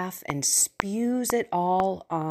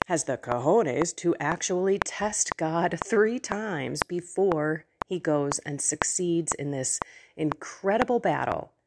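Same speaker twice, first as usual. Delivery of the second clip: ordinary delivery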